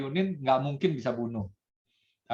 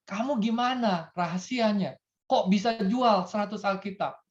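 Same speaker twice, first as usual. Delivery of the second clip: higher, wondering question; amazed pastor; 8 semitones higher than usual. Acted. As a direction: ask in disbelief. (pendeta heran dengan nada tinggi)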